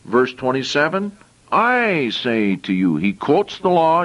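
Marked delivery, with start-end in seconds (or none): 1.5-3.0 slow, "I" stressed, falling; emphasized I. Shown as a demonstration. Preacher repeating Jesus' phrase, slow stress on I. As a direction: spell out each word with weight on "I". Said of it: "now I want to emphasize".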